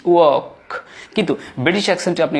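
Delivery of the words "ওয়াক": ওয়াক with no r before k; British pronunciation. Shown as a demonstration. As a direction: say ওয়াক as r-less "work". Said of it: "আমি silent করে দিয়েছি".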